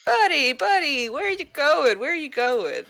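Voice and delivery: high, sing-song coaxing; coaxing call. Acted. Calling after a fleeing warrior, coaxing. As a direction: call after someone as if luring them back.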